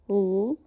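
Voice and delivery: L held and drawn out; sustained L sound; long L. Shown as a demonstration. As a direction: stretch the L. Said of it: "the long one".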